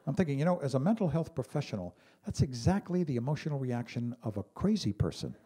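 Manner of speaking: ordinary talk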